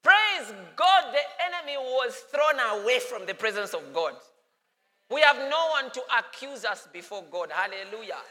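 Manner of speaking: full-force sermon proclamation; fervent preaching. Acted. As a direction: preach it out with fire.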